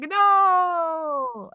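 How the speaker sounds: high, loud panicked cry of no. Retold or imitated. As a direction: yelp it out in sudden panic. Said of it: "And I was like".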